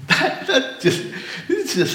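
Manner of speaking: laughing in delight. Real, not acted. Preacher overcome mid-sermon.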